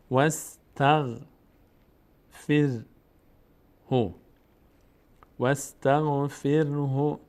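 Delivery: واستغفره drawn out slowly, each sound clear; slow model word. Shown as a demonstration. Teacher models واستغفره for viewers, slowly. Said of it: "teacher models the word".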